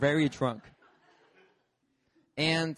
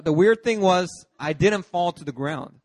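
normal talking voice

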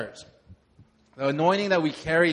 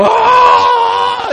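very high, loud yell from the back; imitated shout; 16 dB louder than usual. Pastor imitates a man shouting at the altar call, loud and high. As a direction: belt out a high "Ah!" like a man overcome. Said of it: "I hear him go".

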